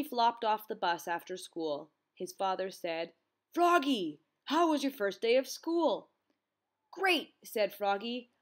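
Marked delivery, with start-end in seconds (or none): 3.5-6.1 eager greeting. A narrator's reading. Father greeting Froggy after school. 6.9-7.3 high-pitched exclamation; enthusiastic answer. A narrator's reading. Froggy telling father about school, enthusiastic answer.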